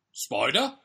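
loud, rising disbelief; put-on father's voice, swooping up; father's incredulous query. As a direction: question it as a scoffing dad. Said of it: "said her father".

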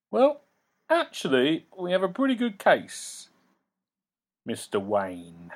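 a lawyer savouring his trump card; smug lawyer. Acted.

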